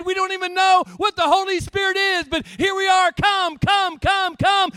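loud, high, pleading shout; fervent crying out. Retold or imitated. The disciples in the upper room, shouting their plea. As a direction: cry out to God with full force. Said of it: "Like Jesus said".

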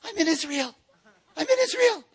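high, rising excited squeal; mock excited I'm in Israel. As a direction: sound thrilled to be there. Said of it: "She just went".

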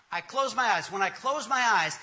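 ordinary talk

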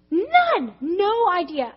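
high, loud, emphatic denial; emphatic disbelief. Real, not acted. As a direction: punch it out, pitch jumping up. Stressing her total disbelief.

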